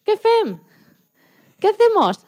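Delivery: high, lively mock alarm at rough sea; excited asking. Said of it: "nos dijimos".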